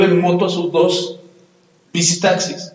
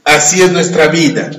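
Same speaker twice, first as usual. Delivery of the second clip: louder, measured Bible reading; scripture reading; 4 dB louder than usual. Acted. Pastor reads Santiago chapter four aloud to the church, proclaiming. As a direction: proclaim the verses to the congregation.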